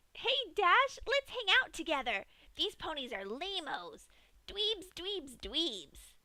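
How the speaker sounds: high, loud cocky voice; mock the dead friend's brash tone; mimicking Rainbow-like friend. A narrator's reading. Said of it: "Pinkie mimicked".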